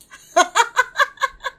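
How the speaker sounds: loud, high staccato laugh. Real, not acted. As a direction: burst into a high-pitched cackle. Laughs after joking about team.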